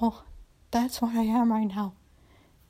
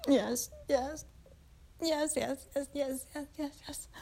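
yeses growing much softer to a breath; fading moans; 2 semitones higher, 5 dB quieter than usual. Acted. Woman near climax for her master, fading yeses. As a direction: let each yes fade away.

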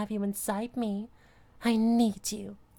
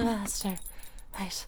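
ordinary delivery

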